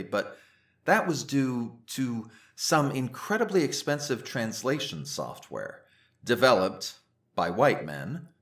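normal talking voice

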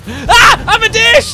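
loud, high-pitched yelp; panicked shriek. Pretend. Tasty dish attacked by a game monster, playful panicked shriek. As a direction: shriek as if about to be eaten.

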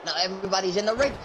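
ordinary talk, nothing marked

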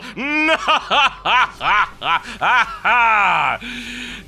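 loud, high maniacal laughter; long villainous cackle, full voice; evil villain laugh. Acted. Villain crowing over the doomed city, maniacal laugh.

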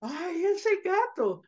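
very high, small-voiced lament; tiny squeaky echo of a shaken sigh; little girl. Little girl next to her brother, shaken. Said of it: "the little girl sits next to him".

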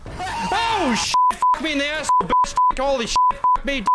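high, loud panicked swearing; startled cursing. Real, not acted. Gamer jump-scared by the monster, high loud cursing. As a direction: yelp and curse in real fright.

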